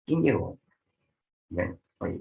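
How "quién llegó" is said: ¿Quién llegó? high on Quién, falling through llegó; descending question tune. Shown as a demonstration. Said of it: "de ambas preguntas".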